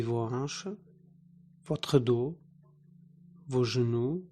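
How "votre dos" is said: ordinary talk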